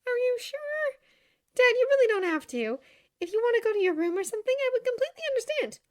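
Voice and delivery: high-pitched, anxious daughter; concerned daughter. Acted. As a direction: gently offer an out, voice pitched high.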